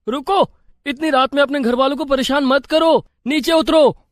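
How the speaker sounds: high-pitched, agitated calling; alarmed urging. Acted. Husband to wife climbing the wall at night, alarmed.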